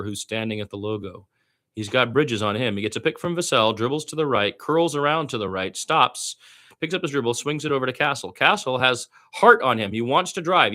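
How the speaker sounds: lively radio play-by-play call of a Spurs game. Acted. Fan practicing radio play-by-play.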